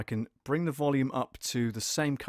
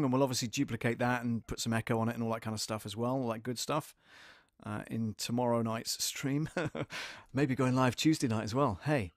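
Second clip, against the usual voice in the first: ordinary talk, nothing marked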